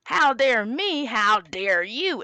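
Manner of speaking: slow, hammed-up back-and-forth indignation; mock indignant squabble. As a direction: imitate a couple trading indignant retorts. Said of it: "they just go back and forth".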